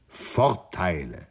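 slow, bitter echo of the word; bitter retort. A narrator's reading. Nanda bitterly throws the word back at Sri Daman. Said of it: "versetzte Nanda nicht ohne Bitterkeit".

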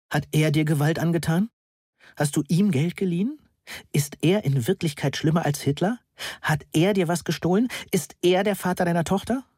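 high-pitched volley of anxious questions; blurted questions. A narrator's reading. Friend questioning crying Moni about a man, words blurting out. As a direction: let each question push out, lifting at the end. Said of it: "bevor noch mehr Worte herausdrängten".

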